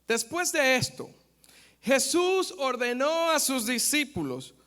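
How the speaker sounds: loud, fervent scripture reading from the pulpit; preaching at full force. Acted. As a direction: proclaim the passage to the congregation, loud.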